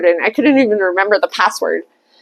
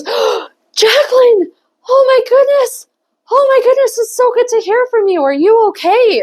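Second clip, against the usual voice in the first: overly concerned client; 13 semitones higher than usual. Retold or imitated. Client on the phone gushing over her. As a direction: gush with worried sympathy.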